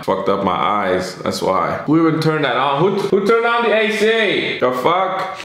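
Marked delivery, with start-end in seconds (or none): 1.9-5.4 mock outrage. Blaming the AC for his tears, high mock outrage. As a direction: pretend to be outraged at the AC.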